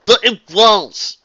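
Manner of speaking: slow, loud insistence; arguing. Acted. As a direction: dig in and insist. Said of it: "A J argued".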